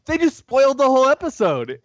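high, loud fake indignation; hammed-up fan outrage; mock outrage. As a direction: squeal it like a betrayed fan. Fan voicing his own outrage.

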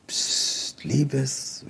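soft, hushed shushing; whisper. A narrator's reading. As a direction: hush the child secretively. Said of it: "wisperte der Zauberer". (leise gewispert)

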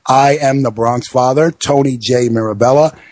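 normal talking voice

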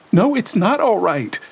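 high, loud, upset denial; distraught Lena. A narrator's reading. Lena rejecting Gabriel's comfort, distraught. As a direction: fling the reassurance back at him.

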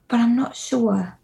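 ordinary delivery

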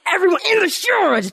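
fast, high-pitched character bark; Vincent D'Onofrio. Retold or imitated. Mom redoing her shower order for laughs, hammed-up. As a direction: put on an over-the-top frantic command. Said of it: "I brought that Vincent D'Onofrio back out".

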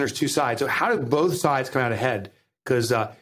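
ordinary talk, nothing marked

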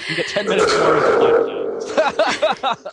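loud laughing prize announcement; infomercial. Pretend. Mock telethon reward, laughing.

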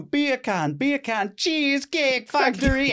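high mock Jamaican beer can and Cheesecake Factory; imitating a Jamaican accent, up high. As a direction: belt it out in a hammed-up Jamaican accent. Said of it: "we're just going".

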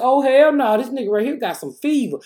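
loud, high shocked outburst; sudden loud startled cry; alarmed exclamation. Retold or imitated. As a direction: react to the heated wipe. Alarmed at hot forehead.

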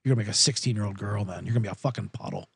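low, soft, fast sarcastic aside; deadpan bartender grumbling about a drunk customer. Retold or imitated. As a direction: mutter it dry and quick under your breath.

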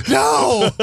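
high, drawn-out film-parody holler; mock shouted refusal. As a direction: cut in with a hammed-up cry. Acted interruption in film scene.

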